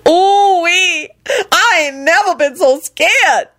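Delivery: high, drawn-out woman's exclamation; shaken Lucretia voice. A narrator's reading. Lucretia's relieved character voice.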